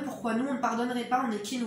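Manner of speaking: ordinary talk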